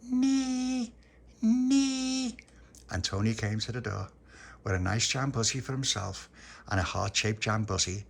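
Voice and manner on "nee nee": very high, long squealed call; baby's shout. Acted. Baby Lucia shouting through Tony's letterbox, high call. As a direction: toddler hollering one stretched syllable. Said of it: "she shouted through the letterbox".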